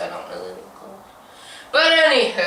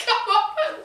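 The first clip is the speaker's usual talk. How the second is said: very high, laughing call; pitched-up retold urging; excited beckoning. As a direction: squeal it through a laugh. Retold urging friend over.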